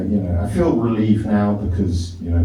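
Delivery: normal talking voice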